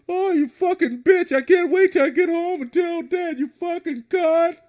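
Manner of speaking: put-on high, loud, slow screaming child; bratty kid tantrum. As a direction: yell it like a spoiled boy throwing a fit. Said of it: "a little boy in Walmart".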